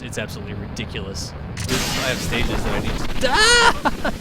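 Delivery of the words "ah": sudden high loud yelp of surprise; a real startled cry, much higher. Real, not acted. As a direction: yelp as the rocket blows up. Rocket blows up again.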